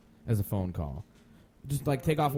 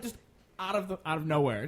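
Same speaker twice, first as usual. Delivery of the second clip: ordinary talk, nothing marked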